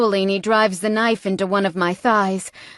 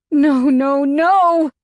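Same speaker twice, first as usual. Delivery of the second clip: much higher, louder terrified no; panicked cry; 6 semitones higher, 4 dB louder, much slower than usual. A narrator's reading. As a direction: cry out in terror. Realizes what he will do.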